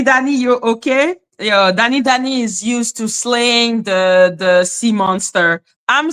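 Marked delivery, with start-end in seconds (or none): none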